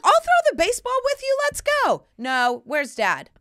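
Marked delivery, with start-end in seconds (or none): 0.0-2.0 much higher, louder, fast eager offer; eager playmate. Retold or imitated. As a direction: bounce in, overeager to play. Eager parent offering to play.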